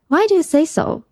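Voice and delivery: ordinary delivery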